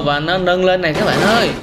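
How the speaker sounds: ordinary talk, nothing marked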